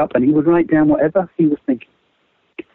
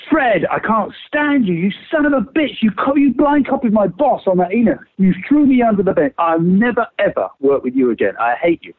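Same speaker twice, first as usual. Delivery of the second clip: mock angry rant; 7 semitones higher than usual. Acting out an angry argument.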